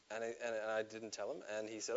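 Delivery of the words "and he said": ordinary talk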